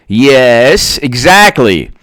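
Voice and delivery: ordinary talk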